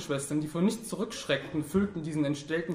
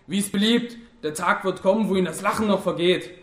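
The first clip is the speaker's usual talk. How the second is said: louder, higher, bitter threat; grim, dogged; 4 semitones higher, 6 dB louder than usual. A narrator's reading. Wounded man threatens the one who laughed, grimly. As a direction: grimly push it out through the pain. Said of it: "und sagte verbissen".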